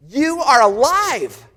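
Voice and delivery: loud, slow cry of amazement, pitched high; overjoyed cry. Acted. To risen Jesus in the room, a loud slow joyful cry. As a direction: shout it out in stunned joy.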